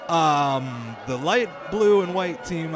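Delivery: loud, high play-by-play burst with laughs; a hammed-up reporter losing it as the game ends; excited live reaction. Reporter shouting to listeners as the match suddenly ends, loud and excited.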